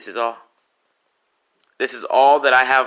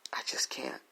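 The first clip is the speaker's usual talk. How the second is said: whispered, voice gone; 11 semitones lower, 15 dB quieter than usual. Retold or imitated. Son's last words to his dying mother, whispered. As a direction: breathe it out with nothing left.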